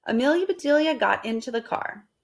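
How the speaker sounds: ordinary delivery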